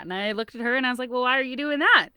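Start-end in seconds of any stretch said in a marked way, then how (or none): 1.1-2.1 mock retort. Mother throws question back.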